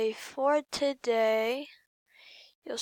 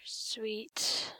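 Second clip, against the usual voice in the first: ordinary talk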